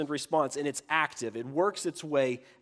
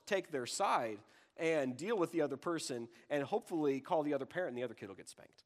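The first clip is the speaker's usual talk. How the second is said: usual speaking voice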